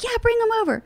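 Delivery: high, bright imitation of the host; a light, sing-song bring-him-over; mock high excited voice. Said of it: "He's like".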